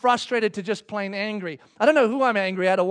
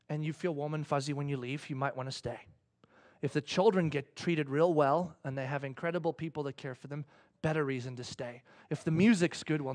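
ordinary delivery

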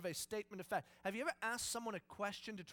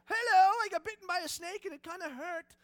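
panicked caller; 10 semitones higher, 12 dB louder than usual. Pretend. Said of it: "you call nine one one".

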